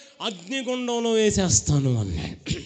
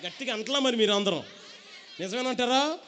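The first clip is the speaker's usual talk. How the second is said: ordinary delivery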